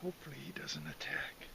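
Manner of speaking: hushed whisper near wildlife. Real, not acted. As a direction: whisper so the deer stays calm.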